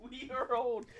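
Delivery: loud, laughing and high. Real, not acted. Laughing at their age.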